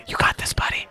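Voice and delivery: soft unvoiced whisper of support; hushed whispered cheer; whispered encouragement. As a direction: breathe it like a quiet pep talk. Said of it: "you're like".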